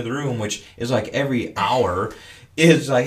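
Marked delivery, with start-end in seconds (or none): none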